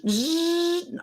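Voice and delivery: a high, thin buzz like a fly. Shown as a demonstration. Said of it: "you can voice text sound effects".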